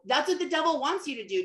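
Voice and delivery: usual speaking voice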